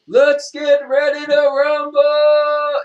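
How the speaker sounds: high, loud, stretched-out rumble call; boxing ring announcer. Shown as a demonstration. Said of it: "he wants ring announcer voice".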